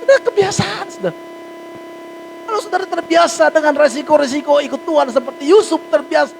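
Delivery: high, loud preaching push. Acted. Pastor urging the congregation to pray, high and loud. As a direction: preach it to the back row.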